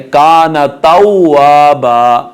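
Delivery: तौआबा slowly with rounded w, long final aa; rounded waw. Shown as a demonstration. Teacher modelling the ending, rounded waw. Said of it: "वाव के ऊपर होंठों को गोल किया जाएगा".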